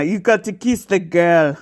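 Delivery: hammed-up Caribbean cartoon crab; Sebastian the Crab. Host jokes that her friend sounds like this, playful crab impression. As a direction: do a mock Jamaican-accented crab. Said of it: "Sebastian the Crab".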